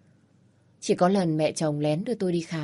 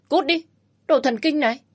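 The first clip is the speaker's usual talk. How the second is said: much higher, louder shrieking insult; furious shout; 8 semitones higher, 5 dB louder than usual. Acted. Woman shrieks at the man to get out, furious. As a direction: scream him out of the room. She chases him out.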